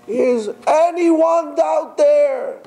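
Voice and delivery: high slow pleading call; crying out. Retold or imitated. Climber with dry throat, slow high pleading call. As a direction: stretch the call out in despair. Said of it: "he keeps on crying".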